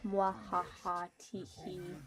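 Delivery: slow mock evil laugh then giggle; wild unhinged ranting. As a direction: cackle like a cartoon villain.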